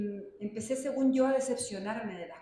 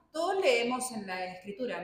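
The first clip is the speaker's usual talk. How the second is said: ordinary delivery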